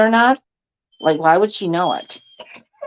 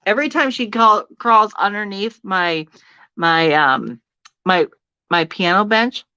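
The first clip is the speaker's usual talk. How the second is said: ordinary delivery, nothing marked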